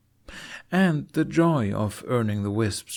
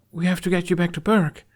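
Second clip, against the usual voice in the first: put-on young boy voice; 9 semitones higher, 4 dB louder than usual. Jamie voiced.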